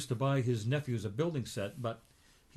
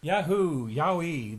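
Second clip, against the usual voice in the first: louder, higher whoops of joy; joyful whoops; 3 semitones higher, 5 dB louder, slower than usual. Acted. Donald sees four new cars, whooping with joy.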